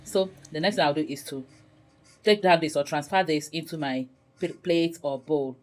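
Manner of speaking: ordinary talk, nothing marked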